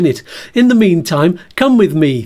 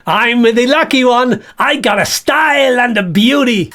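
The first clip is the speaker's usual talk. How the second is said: higher, boastful puppet voice; Bert voice; 5 semitones higher than usual. Acted. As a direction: gloat as the vain bag. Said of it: "This is Bert the Bag".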